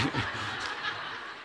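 quiet unvoiced chuckle; a soft laugh under the breath; soft breathy laugh. Real, not acted. Pastor laughs at his own joke, soft chuckle.

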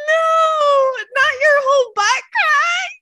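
loud, high, slow cry of disbelief; shocked shout. Real, not acted. Seeing the listener's butt crack photo, shocked laughing shout. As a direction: wail it in horrified delight.

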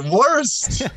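"Worst!" exclaimed high and loud, falling; outraged exclamation. Real, not acted. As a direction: fling the word back, indignant and loud. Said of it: "I was gonna let it hang there".

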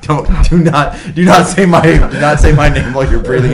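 laughing protest. Real, not acted. Friend protests the breathy Jack bit.

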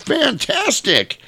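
high, drawn-out exclamation; impressed cheer. Retold or imitated. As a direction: exclaim it slowly in a high put-on voice. Don Adams cheers the flight.

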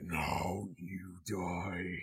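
very slow, low, hushed villain threat; modulated Freddy. Retold or imitated. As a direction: stretch it out deep and soft, imitating a modulated monster voice. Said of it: "it is a modulated voice".

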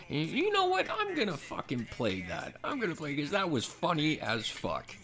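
excited announcement. Real, not acted. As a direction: hype up what's coming next. Excited to play the clip.

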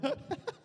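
short high giggle; real giggle, soft and high; high soft laugh. Real, not acted. Laughing after teasing.

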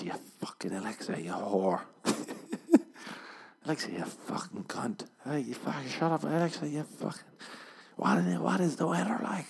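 mock angry outburst, climbing in pitch; snapping. Man snapping at unresponsive Alexa, mock angry. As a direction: act out losing your temper at a gadget. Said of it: "he snaps".

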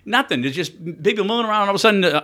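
ordinary talk, nothing marked

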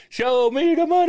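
high, loud movie-quote shout; Jerry Maguire. Retold or imitated. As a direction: belt the line like an excited agent. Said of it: "Jerry Maguire".

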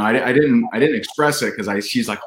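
ordinary talk, nothing marked

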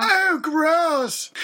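high, drawn-out disgusted cry; exaggerated grossed-out reaction; mock disgust. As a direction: squeal it in revulsion.

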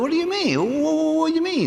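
high, puzzled stammering voice; mock simple fella stammering; dim waiter.

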